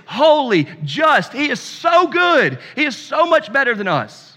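high, loud, urgent sermon delivery; fervent preaching. Acted. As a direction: preach it out with full force to the congregation. Preaching at full force.

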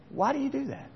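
put-on high, soft child voice asking; lightly voiced curious child; child's question. Children asking dad at Passover, high soft voice.

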